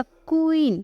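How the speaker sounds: क्वीन lifted and held a little; pronounce queen. Shown as a demonstration. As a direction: model क्वीन for the students to repeat. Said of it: "pronunciation करो".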